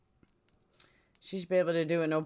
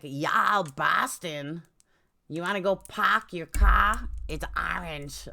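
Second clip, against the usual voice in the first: exaggerated Boston vowels, dropped r's; mock Boston accent; slower than usual. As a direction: imitate a horrible Boston accent. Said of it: "Boston accents are like chalk on".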